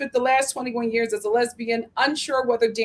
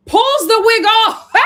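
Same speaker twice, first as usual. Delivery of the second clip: high, loud, drawn-out shriek; shrieked reveal; 12 semitones higher, 10 dB louder, slower than usual. Real, not acted. Recapper revealing the confessional wig moment, shrieking. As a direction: shout it in scandalised disbelief, ending in a squeal.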